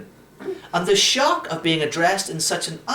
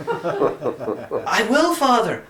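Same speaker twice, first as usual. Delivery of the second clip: higher, relieved, nearly laughing; with near joy; 5 semitones higher, much slower than usual. A narrator's reading. Boy answers the priest, relieved and nearly joyful. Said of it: "with something approaching joy".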